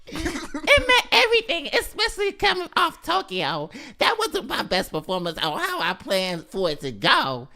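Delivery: tiny, squeaky high-pitched little gymnast; gymnastics voice. Acted. Host reads gymnast's quote teasingly, tiny squeaky gymnast voice. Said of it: "That's my gymnastics voice".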